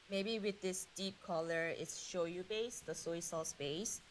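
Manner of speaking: normal talking voice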